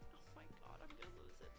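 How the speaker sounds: high, soft exasperated squeak. Real, not acted. Narrator after another flubbed take, quietly exasperated.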